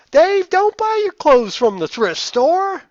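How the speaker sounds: very high, loud, slow caricature of a mom; mom character voice. Acted. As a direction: nag in a hammed-up, high-pitched mom voice. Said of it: "that's my mom character voice".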